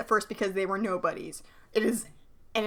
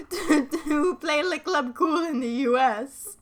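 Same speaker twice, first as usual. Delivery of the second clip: a higher, slow put-on foreign accent, half-remembered; vague Countess accent; 5 semitones higher, slower than usual. Cohost voicing Minx announcing the invitation, vague foreign accent. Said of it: "I don't remember what her fucking accent is".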